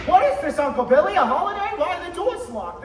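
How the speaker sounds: loud, sharp questions; alarmed demand. Acted. George confronting Uncle Billy about locked doors, loud alarm. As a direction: demand answers, startled and angry.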